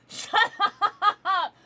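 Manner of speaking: high, loud, breathy laugh through "Shut". Real, not acted. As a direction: laugh it out in disbelief. Laughing at a joke.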